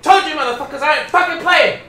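loud, high combat shouting; fired-up yelling. Real, not acted. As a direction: yell threats in the heat of a fight. Yelling while fighting off zombies.

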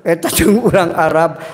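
ordinary talk

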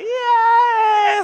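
very high loud yeah; mock kid cheer. The boy cheers that he gets to choose the song, high and loud. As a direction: squeal it with childish delight.

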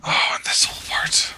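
hushed dread, no voice; whispering. Real, not acted. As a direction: breathe it like a secret. Realizing a dreaded game section.

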